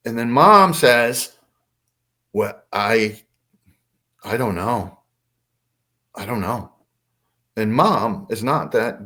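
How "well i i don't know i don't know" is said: halting and lower, trailing into doubt; imitating a bewildered mother groping for an answer; confused, unsure. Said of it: "She was confused and unsure".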